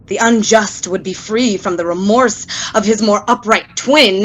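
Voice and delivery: ordinary talk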